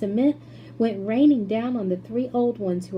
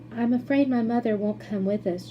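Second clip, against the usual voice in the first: plain reading voice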